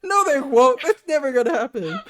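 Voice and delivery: hammed-up reassuring mom, laughing through it; dismissive mom. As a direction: wave it away like a clueless radio mom. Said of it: "And she's like".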